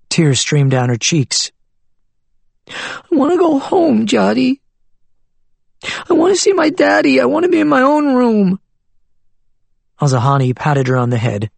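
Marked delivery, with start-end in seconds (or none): 2.7-8.6 put-on very high, pleading child's voice; crying little girl. Granddaughter begs grandfather to go home, tearfully pleading. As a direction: beg for home through tears.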